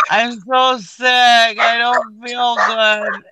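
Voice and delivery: high, drawn-out tearful wail; retold crying. In the car after slipping on ice, stretched-out tearful wail. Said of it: "I was just bawling my eyes out".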